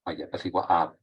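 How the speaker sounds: normal talking voice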